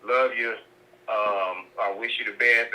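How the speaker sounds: normal talking voice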